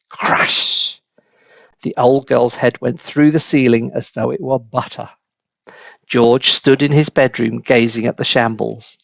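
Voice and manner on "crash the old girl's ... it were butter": ordinary delivery, nothing marked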